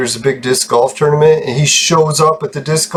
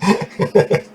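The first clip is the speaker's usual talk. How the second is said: airy giggle; high breathy laugh; 8 semitones higher than usual. Real, not acted. Giggling at his own joke about the Raptors.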